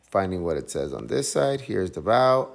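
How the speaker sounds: ordinary delivery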